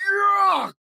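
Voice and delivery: very high, loud wailed yuck; wailing yuck. A narrator's reading. Big monster wailing after being kissed. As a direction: recoil in horror. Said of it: "The big monster wailed".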